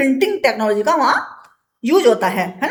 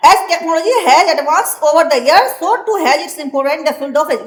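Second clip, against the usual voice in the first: slow, deliberate dictation pace; teacher dictating from the book; slow textbook reading; 2 semitones higher, 3 dB louder, much slower than usual. Acted. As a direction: read it out word by word for the class. Reads textbook English aloud slowly.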